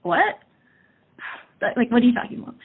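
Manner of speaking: sharp, high what, taken aback; a jolt of disbelief at her brother; retold surprise. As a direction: recall the shock of the question. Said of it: "And I went".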